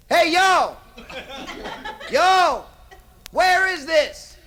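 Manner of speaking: loud, high hail, last line slow; calling out. Acted. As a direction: holler across the water. Chris calling out to shore.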